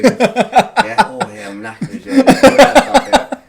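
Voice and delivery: high, loud breathless laughing gasps; mock exhausted gym panting; gasping for breath. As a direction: pant it out, laughing. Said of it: "taking deep breaths in".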